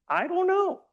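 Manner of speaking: high, rising unsure voice; puppet-like high uncertain squeak. Shown as a demonstration. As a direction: sound unsure, pitch way up. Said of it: "That was an example".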